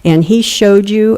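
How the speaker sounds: normal talking voice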